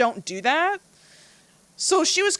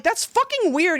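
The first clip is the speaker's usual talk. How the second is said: higher-pitched mild mock outrage; retold angry yell; 8 semitones higher than usual. Telling off the guy who kissed her, mild retold yell. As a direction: replay the telling-off, pitch raised but not shouted. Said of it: "I just fucking yelled at him".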